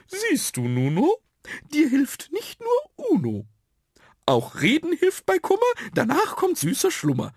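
very high little creature voices; high, sing-song storybook figures; chorus of dots. A narrator's reading. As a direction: chirp the rhyme in a high put-on sprite voice. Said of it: "meinten die Punkte im Chor".

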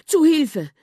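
high, loud panicked cry; a little mouse crying out in fright; frightened cry for help. A narrator's reading. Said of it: "Millers Stimme bebte".

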